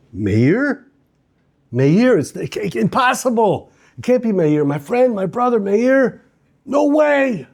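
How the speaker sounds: high, rising cries of disbelief; horrified recognition of his brother; seeing his friend tied to the tree, stunned. Acted.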